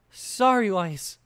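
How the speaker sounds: high, loud, shaky apology; nervous stutter. A narrator's reading. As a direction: blurt it out nervously. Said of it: "the younger girl stuttered".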